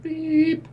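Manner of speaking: high sustained beep noise; a flat electronic beep sound; imitated beep. GM imitating the door beeping, high electronic beep. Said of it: "It just beeps again".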